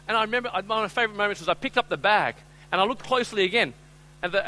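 ordinary delivery

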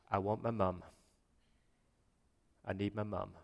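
soft, low plea for mum; hurting child's plea. Retold or imitated. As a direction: quietly voice a hurt child wanting comfort. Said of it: "He just said".

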